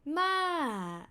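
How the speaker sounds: Ma starting high then falling; falling tone demo. Shown as a demonstration. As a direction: let Ma fall from high. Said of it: "falling tone means grandma".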